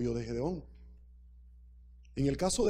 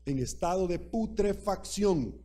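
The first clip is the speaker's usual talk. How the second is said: slow, weighty "en estado de putrefacción", each syllable of putrefacción drawn out; slow emphatic stress on "putrefacción"; 4 semitones higher, slower than usual. Real, not acted. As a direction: lean on "putrefacción" slowly to drive the point home. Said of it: "lo trascendente es esto".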